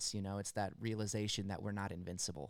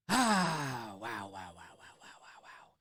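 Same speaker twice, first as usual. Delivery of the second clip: stunned, fading; slower than usual. Real, not acted. Reactor stunned by the song, fading to a whisper. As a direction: let the wows die away to a breath.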